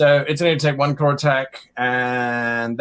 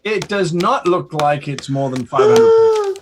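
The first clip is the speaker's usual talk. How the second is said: usual speaking voice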